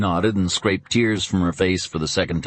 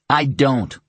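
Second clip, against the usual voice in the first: deliberately; 7 semitones higher, 3 dB louder than usual. Acted. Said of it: "Tom returned deliberately".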